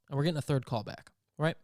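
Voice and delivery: ordinary delivery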